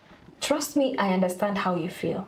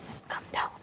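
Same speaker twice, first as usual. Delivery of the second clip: whispered calming; 9 dB quieter than usual. Acted. Counselor soothing quietly.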